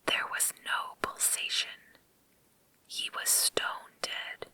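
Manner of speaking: plain reading voice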